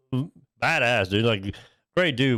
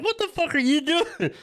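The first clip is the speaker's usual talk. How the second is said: high, slow, stunned disbelief; incredulous; 13 semitones higher than usual. Retold or imitated. Friend shows the puddle under his chair, high disbelieving cry. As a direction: stretch it out in high-pitched shock. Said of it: "And I was like".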